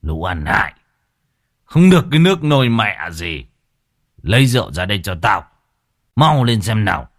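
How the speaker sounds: put-on drunken angry snarl; snarled at kids. A narrator's reading. Drunk father snarling at his daughters for liquor. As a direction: rail at the useless children. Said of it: "rồi rít lên".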